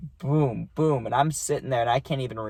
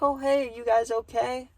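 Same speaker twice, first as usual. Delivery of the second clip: much higher, sweet motherly voice; his friend's mom asking at night if they're okay, in a much higher motherly voice; mom's voice; 12 semitones higher than usual. Retold or imitated. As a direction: chirp it like a surprised mom checking in. Friend's mom surprises him at night.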